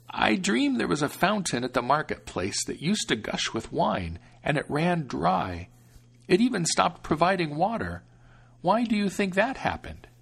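protective grandmother, unhurried; old grandmother. Acted. Grandmother recounts dream.

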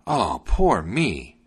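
slow, swooping lament; theatrical slow wail; crying out. A narrator's reading. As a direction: draw each word out in self-pity. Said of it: "he cried out once more".

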